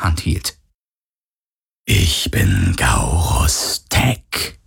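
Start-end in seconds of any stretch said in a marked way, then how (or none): 1.9-4.6 very low, drawn-out phantom; slow, deep voice of a ghostly tormentor. A narrator's reading. Phantom to its victim Galen, deep and drawn out.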